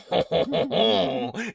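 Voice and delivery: loud, high French "ho ho ho"; exaggerated French laugh to get into character; hammed-up French chuckle. Shown as a demonstration. Voice actor shows French warm-up ritual, hammed-up chuckle. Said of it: "the way that I'd get into it".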